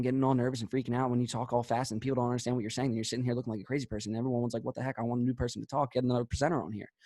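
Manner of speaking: rushed, low, soft mumble; nervous fast mumbling. Shown as a demonstration. Coach shows presenters what not to do, mumbling fast. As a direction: race through it all in one run, hard to follow. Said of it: "Don't be sitting there mumbling".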